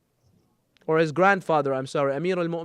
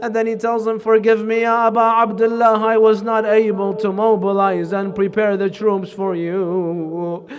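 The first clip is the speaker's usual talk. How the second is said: mourning elegy; 4 semitones higher, 7 dB louder than usual. Acted. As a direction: preach it as a rising lament for the martyr. Preacher builds into elegy recitation.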